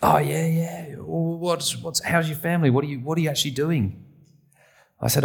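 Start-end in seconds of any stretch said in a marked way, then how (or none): 0.0-4.0 put-on high, breathy, whispered drunk friend; a lightly voiced tipsy mate prying. Friend pressing Wallace about his Sunday, tipsy and high. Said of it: "They were well-oiled".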